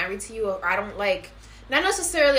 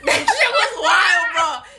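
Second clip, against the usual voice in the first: high, loud burst of laughter into words; laughing hard; 6 semitones higher, 5 dB louder, much slower than usual. Real, not acted. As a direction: crack up and laugh it out. Cracking up at the story.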